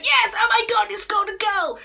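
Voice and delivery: excited commentator. Shown as a demonstration. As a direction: burst out like a goal scream. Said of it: "will be the next thing you say".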